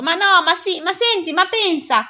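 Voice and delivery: high, flustered exclamations; vain attempts to calm. A narrator's reading. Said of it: "cercava invano di calmarla esclamando".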